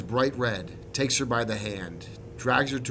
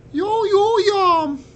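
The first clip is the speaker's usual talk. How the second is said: very high, loud drawn-out call; whistling exclamation; 12 dB louder, much slower than usual. A narrator's reading. Resistance captain reacts to the yam's name, high whistling call. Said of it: "she whistles".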